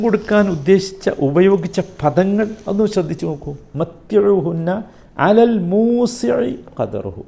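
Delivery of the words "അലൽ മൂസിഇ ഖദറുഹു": slow, raised, drawn-out verse chant; Quran recitation. Acted. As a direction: lift and stretch each word of the verse. Quotes the verse again. (ഉയർന്ന സ്വരത്തിൽ പതുക്കെ പാരായണം)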